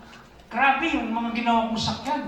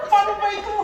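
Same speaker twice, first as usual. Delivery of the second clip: laughing; 13 semitones higher, 6 dB louder, faster than usual. Retold or imitated. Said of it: "Sabi ko".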